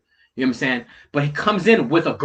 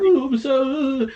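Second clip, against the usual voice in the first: higher, slow whiny sorry; drawn-out pitched-up mock apology; mock sheepish apology; 9 semitones higher, slower than usual. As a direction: parody of the guy's weak excuse. Mocking guy's apology.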